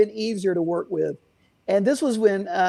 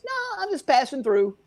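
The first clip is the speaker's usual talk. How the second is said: put-on New York accent; 7 semitones higher than usual. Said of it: "in the thickest New York accent".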